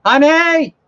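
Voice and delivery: loud, high call to a partner in another room; real yell. Real, not acted. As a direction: holler for your wife through the house. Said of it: "We'll just yell at her".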